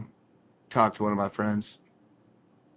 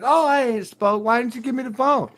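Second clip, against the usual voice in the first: high-pitched mock mom; imitating mom; 12 semitones higher than usual. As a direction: squawk like an upset mom. Mom voiced in story.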